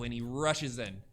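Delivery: usual speaking voice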